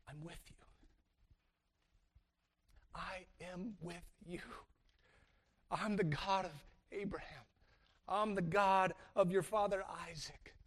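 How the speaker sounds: whisper-close reassurance; quiet tender promise. Pretend. God reassuring Jacob, soft and tender. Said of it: "It's like".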